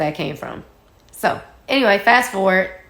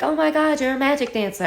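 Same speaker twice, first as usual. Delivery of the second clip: put-on higher, thrilled kid's gush; excited little fan; 7 semitones higher, faster than usual. Young son meeting the dancer, thrilled. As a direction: gasp it like a starstruck child. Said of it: "the son ran up to me and was like".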